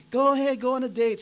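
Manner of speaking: put-on high, loud pushy boy voice; eager kid. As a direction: shove them out the door as the kid. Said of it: "son laughing right there".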